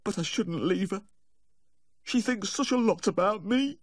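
very high tearful lament; anguished self-reproach. A narrator's reading. Guilty owner lamenting leaving his devoted dog, anguished. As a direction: lament it in a high, wailing pitch. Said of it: "he said, wringing his hands".

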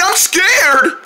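high, panicky squeal; hammed-up frightened cry; mock fear. Fleeing the gator in play.

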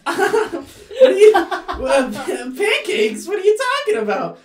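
very high, loud flustered squeak; tiny bully exposed by a tattletale; indignant little creature. Acted. Bully mushroom reacts to Pancakes' accusation.

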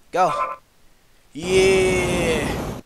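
long triumphant yeah, loud; excited yell. Real, not acted. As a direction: cheer the win. Celebrating a win in game.

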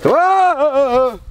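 high loud startled cry; startled yelp. Real, not acted. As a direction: yelp in fright. Startled by creature.

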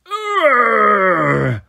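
loud, pained grunt; pained giant grunt. Acted. The giant is stabbed in the thigh, grunting. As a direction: grunt as the blade goes in.

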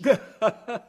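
a short hearty laugh. Acted. Sailor laughs at the boy's apt answer, hearty laugh.

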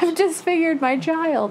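high, slow, laughing voice of a horrified father; horrified dad. Acted. Voicing the poor dad after the mower accident, high and laughing.

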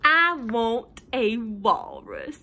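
slow, loud, stretched-out pigeon voice; whiny pigeon demand. Acted. Pigeon switching its wish to a walrus, slow whiny demand. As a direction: drag out the demand like a spoiled bird. Pigeon whines for new pet.

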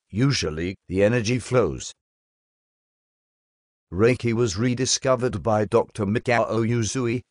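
ordinary talk

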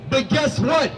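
usual speaking voice

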